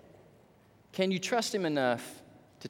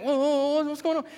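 high-pitched acted bewilderment; startled confusion; 12 semitones higher, 3 dB louder than usual. Preacher as disciples answering Jesus' call, startled. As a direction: react as if suddenly summoned.

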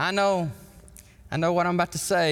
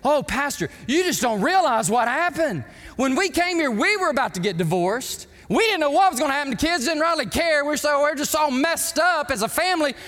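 much higher and louder, rushed heartfelt confession; grateful family; 10 semitones higher, 3 dB louder than usual. Retold or imitated. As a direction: pour out the story to the pastor, overwhelmed. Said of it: "came up to him and says".

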